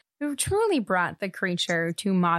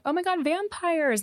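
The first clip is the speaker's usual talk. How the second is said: excited; 9 semitones higher than usual. Retold or imitated. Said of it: "they were like".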